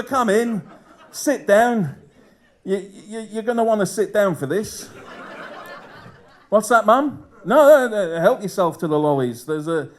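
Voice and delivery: awkward confession to parents. Acted. Son sitting mum and dad down for bad news, awkwardly acted. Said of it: "They're not getting mum and dad over".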